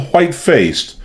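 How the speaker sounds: usual speaking voice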